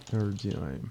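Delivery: ordinary talk, nothing marked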